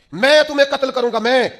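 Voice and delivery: defiant martyr shouting at Hajjaj; booming warning. Acted. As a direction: thunder it back at the tyrant.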